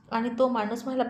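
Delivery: plain reading voice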